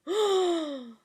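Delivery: sharp high gasp; shocked high gasp; loud gasp. Real, not acted. Reader reacts to twist.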